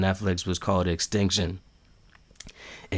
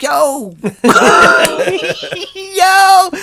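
high, loud yo of disbelief; blown-away shout, cracking up; astonished yell; 7 dB louder than usual. Retold or imitated. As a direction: yelp it through laughter. Retells his stunned reaction.

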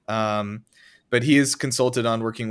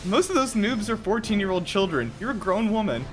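put-on high, whiny teen scolding; indignant kid talking back; 11 semitones higher than usual. Teen scolding mom in skit.

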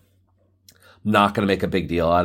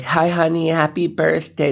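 mom voice; 9 semitones higher than usual. Retold or imitated. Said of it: "She's like".

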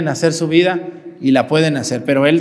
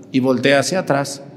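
ordinary talk, nothing marked

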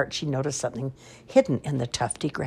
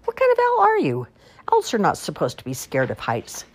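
high, sharp scolding wolf; Wolf scolding the owl, high and exasperated; 4 semitones higher, 5 dB louder than usual. Acted. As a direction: tell her off from up high. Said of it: "scolded Wolf".

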